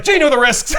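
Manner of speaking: high, loud mock retort; Scott. Scott answering Bones about his wife, shouted retort. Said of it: "And Scott's like".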